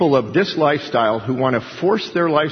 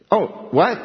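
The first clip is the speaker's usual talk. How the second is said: mock startled staffer; 7 semitones higher than usual. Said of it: "and I go".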